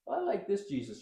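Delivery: mock-sincere sarcastic quote; mock the shallow believer. Preacher mocking a made-up Jesus, sarcastic. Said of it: "say this sarcastically every week".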